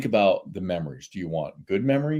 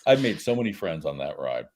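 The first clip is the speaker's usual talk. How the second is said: usual speaking voice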